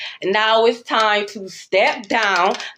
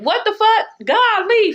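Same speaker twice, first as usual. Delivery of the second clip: shocked reaction; 12 semitones higher, 3 dB louder than usual. Retold or imitated. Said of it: "and I'm like".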